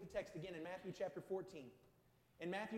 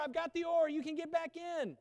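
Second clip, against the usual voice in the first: loud, high coaxing call from the boat; a loud, eager tempter calling across the water; tempting voice; 9 semitones higher, 11 dB louder than usual. Acted. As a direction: shout him back into safety. Tempting voice calling Peter back.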